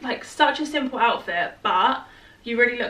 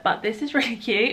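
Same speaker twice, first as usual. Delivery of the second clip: normal talking voice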